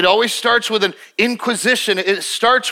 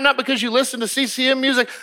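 usual speaking voice

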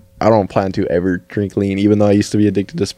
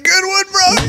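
mocking friend; slower than usual. Homies mocking the seizure.

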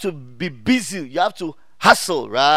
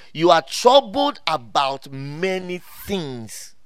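slow, drawn-out quotation; weighty quote; slower than usual. Retold or imitated. Preacher quoting Jesus to Martha, slow and weighty. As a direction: spell it out slowly, word by word.

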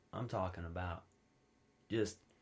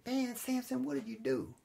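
mock scolding the dog; 12 semitones higher than usual. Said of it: "be like".

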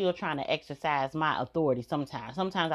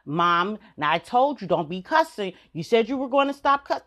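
mock scolding child; 4 semitones higher, 6 dB louder than usual. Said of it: "that motherfucker tell you".

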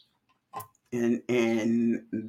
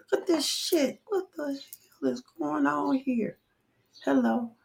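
high squeaky disbelief at her own reflection; shocked at own face; 11 semitones higher, 4 dB quieter than usual. Real, not acted. Streamer seeing herself on camera, shocked high squeal. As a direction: gasp out a startled what-the.

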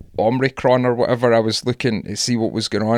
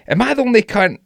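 incredulous high exclamation; 9 semitones higher than usual. Retold or imitated. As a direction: voice jumps high in disbelief. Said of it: "I look at it and I think".

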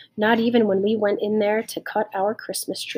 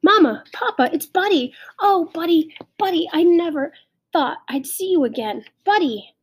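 high-pitched, overjoyed calling; excited girl; 8 semitones higher, 4 dB louder than usual. A narrator's reading. Girl tells parents it's Buddy, excited. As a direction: cry out high, overcome at the reunion. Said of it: "in an excited way".

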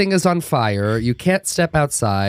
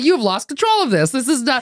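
mock panic; 13 semitones higher than usual. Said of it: "I was like".

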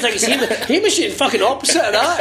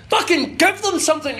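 normal talking voice

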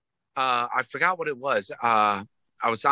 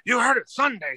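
mock announcement; 9 semitones higher than usual. Announcing album, high.

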